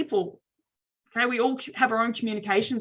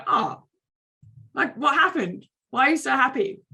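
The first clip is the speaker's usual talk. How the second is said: curious upbeat; 5 semitones higher than usual. Shown as a demonstration. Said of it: "If you look really happy".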